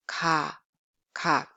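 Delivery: "Ka" with a soft, unaspirated k; soft plain k. Shown as a demonstration. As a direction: say "Ka" low, back of tongue on soft palate. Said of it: "back of your tongue touching a soft palate".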